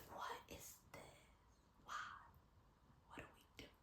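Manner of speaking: soft bewildered whisper; confused whisper. Retold or imitated. Caught on the street by the yelling man, confused whisper. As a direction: whisper it, baffled and scared.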